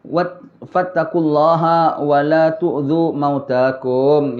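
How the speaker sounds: slow, chanted Arabic recitation; Arabic hadith recited slowly and solemnly. Acted. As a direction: draw out each phrase reverently.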